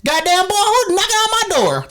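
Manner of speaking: exaggerated high-pitched Southern yell; Southern accent. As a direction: holler it in a comic Southern accent. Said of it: "I turned southern for a second".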